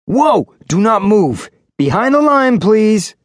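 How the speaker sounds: high, loud urgent call to stop; alarmed warning shout. Acted. Physics student warns the boy away from his lasers, alarmed shout. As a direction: yell to stop him stepping over the line.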